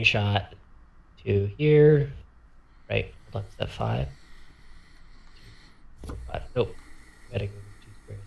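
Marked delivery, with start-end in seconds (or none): 2.9-8.2 very soft, half-whispered working-out; hushed talking to yourself; thinking aloud. Real, not acted. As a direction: count and check under your breath. Working out squares to himself.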